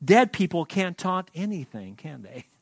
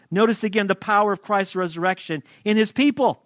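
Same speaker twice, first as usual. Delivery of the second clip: ordinary talk, nothing marked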